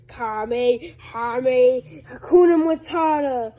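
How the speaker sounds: rising, loud power-up chant; anime attack call. Acted. Bullman firing his beam, rising anime attack shout. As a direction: charge it up like a Kamehameha.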